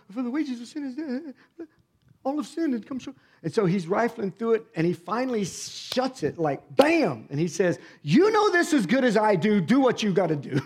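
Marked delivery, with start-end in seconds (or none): 0.1-3.2 nervous pastor. Retold or imitated. Nervous pastor quoting scripture. 8.0-10.6 louder, higher, firm declaration; emphatic pastor. Retold or imitated. Pastor slams Bible, declares.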